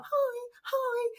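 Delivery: tiny, high, soft greeting; starstruck. Retold or imitated. Nervous newcomer greeting legendary players, starstruck. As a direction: squeak it out, shy and awed. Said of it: "just really starstruck".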